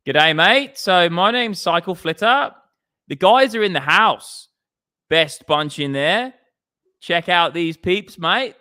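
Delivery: loud, high-pitched Aussie greeting; exaggerated Aussie with lifted line endings; Australian accent. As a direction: put on a big, drawn-out Australian accent. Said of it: "I'll do an Australian accent".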